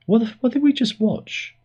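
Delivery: high-pitched, incredulous squawk; appalled disbelief. Retold or imitated. Family reacting to the finale they just saw, high-pitched mock disbelief. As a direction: push the voice way up in mock bafflement.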